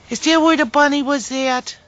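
loud high call to the dog; loud calling question. Real, not acted. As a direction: call the question across the yard. Asking the pet twice.

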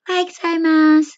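very high-pitched, loud imitation of a Japanese woman; tiny high Japanese woman's voice. Shown as a demonstration. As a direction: make the voice small and high, like a Japanese woman's greeting. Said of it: "as in, parang ganito ha".

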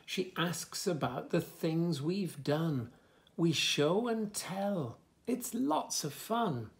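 lively, sing-song story reading; reading to children. Acted. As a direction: bounce the rhymes for little listeners. Reads book text.

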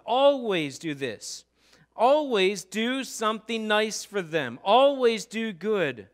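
each always stretched slow and loud; slow emphatic always. Acted. Preacher urging the congregation to always do good, slow. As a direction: press every always slowly on them.

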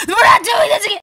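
high, loud whiny cry; crying shout. Acted. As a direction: cry out in protest. Refusal repeated, cry.

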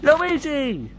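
high pretend cry; calling out. Player to Luigi in a pretend fight, high call.

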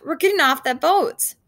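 shouted. A narrator's reading. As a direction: shout the plan over the storm. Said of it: "Duck shouted just before he left".

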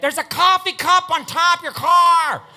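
loud, high, slow shout of a helpful stranger; mock good Samaritan shout. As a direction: yell it like an eager passer-by pointing at your roof. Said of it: "some good Samaritan's gonna be like".

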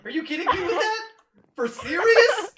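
hammed-up outraged laughter; laughing mock outrage. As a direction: shriek in disbelief while laughing.

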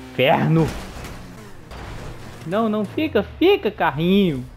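loud pleading at the car; frustrated shout. Real, not acted. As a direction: yell at the car to stay. Car falls off.

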